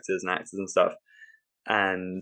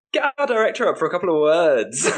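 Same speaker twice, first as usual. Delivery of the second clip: high, loud, over-bright emcee call; strained showbiz holler; forced announcement; 8 semitones higher, 6 dB louder than usual. Acted. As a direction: cover the disaster with a big announcer shout. Awkward host introduces after failed film.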